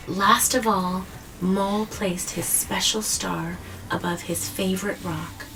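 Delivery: put-on gentle storybook reading to a child; story narration. A narrator's reading. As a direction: read it like a bedtime story, easing down at the end. Reading picture book to child.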